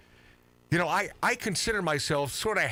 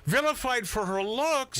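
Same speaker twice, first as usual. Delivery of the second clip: incredulous; 9 semitones higher than usual. Real, not acted. Incredulous reaction to panel.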